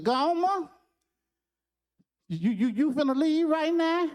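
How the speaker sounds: put-on high child's voice calling out; a scared boy asking Gomer not to leave; anxious little boy. Opie calls Gomer back.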